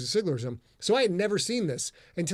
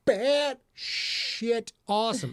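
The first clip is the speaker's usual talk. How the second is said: high, drawn-out exclamation; awestruck outburst; 7 semitones higher, 4 dB louder, much slower than usual. Real, not acted. Host reacts to a fan's tattoo, awestruck outburst. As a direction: stretch each word out high in amazement.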